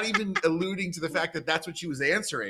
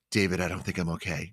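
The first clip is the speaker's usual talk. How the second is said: low worried voice; 7 semitones lower than usual. Retold or imitated. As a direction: say it like you're scared. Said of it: "she goes".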